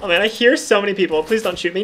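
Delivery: usual speaking voice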